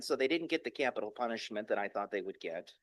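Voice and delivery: ordinary talk, nothing marked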